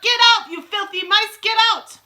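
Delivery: high, loud frantic order; teacher shrieking at vermin; shouting. A narrator's reading. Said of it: "stand upon the desks and shout".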